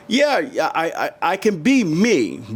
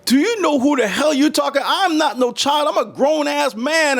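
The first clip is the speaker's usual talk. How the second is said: switched to angry; 11 semitones higher than usual. Retold or imitated. Told to shut up in a store, he fires back angrily. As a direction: snap back, refusing to be talked down to. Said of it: "that's when I switched modes".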